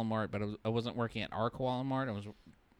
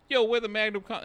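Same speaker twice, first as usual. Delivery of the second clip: put-on louder, higher street-guy accent; 8 semitones higher, 6 dB louder than usual. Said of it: "I'm just doing an accent".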